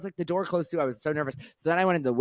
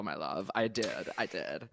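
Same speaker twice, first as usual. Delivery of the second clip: soft fond murmur; 7 semitones lower, 11 dB quieter than usual. Real, not acted. Soft affectionate closing.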